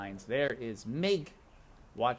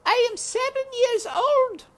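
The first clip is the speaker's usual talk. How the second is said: put-on high, loud child's shout; loud high girl's call; Meg shouting; 9 dB louder than usual. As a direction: shout it after the riders. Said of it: "shouted Meg".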